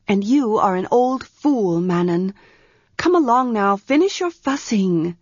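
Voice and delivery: put-on a young priestess pressing for an answer; Arha's voice. Young priestess Arha urgently asks her servant for a room.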